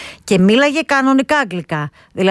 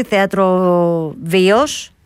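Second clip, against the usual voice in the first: ordinary talk, nothing marked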